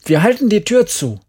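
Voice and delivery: high, loud cry for help; panicked boy calling out; urgent shout. A narrator's reading. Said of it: "schrie er".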